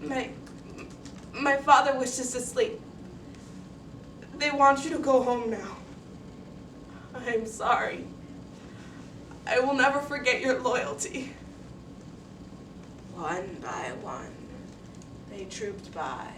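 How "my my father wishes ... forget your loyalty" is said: put-on halting child voice, somewhat raised; choked out. A narrator's reading. Girl sending classmates home, haltingly choked out. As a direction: force it out in separate, effortful phrases. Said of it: "I choked out to the room".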